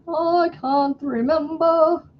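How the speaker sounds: high, slow wistful sigh; high slow lament. Real, not acted.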